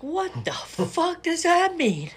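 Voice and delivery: incredulous. Real, not acted. Baffled by Obama's hot dog answer.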